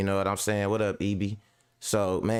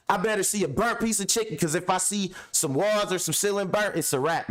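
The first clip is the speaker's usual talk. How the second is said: real angry yelling; 9 semitones higher than usual. Real, not acted. Landlord yells at his tenant about the fire, furious. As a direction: blow up in real outrage.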